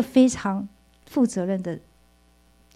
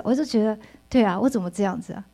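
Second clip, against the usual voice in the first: ordinary talk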